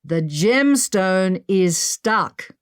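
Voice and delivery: very slow, deliberate words; slow and forceful. Acted. Henry telling them slowly through the slab, forceful. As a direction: spell it out slowly through the wall. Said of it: "in a slow, forceful manner".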